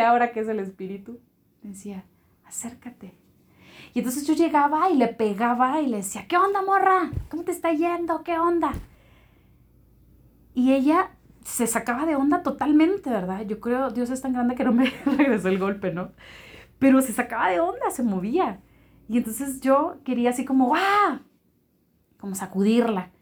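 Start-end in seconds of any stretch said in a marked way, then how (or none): 2.4-3.1 very soft, hushed inner prompting. Retold or imitated. Something inside her says to approach, hushed inner voice. 6.3-8.7 high, loud, rowdy hailing; boisterous greeting. Retold or imitated. Retelling how she shoved the girl in greeting, boisterous call. 20.7-21.2 high, loud burst; startling yell. Retold or imitated. Retelling her urge to shake the girl up, startling yell.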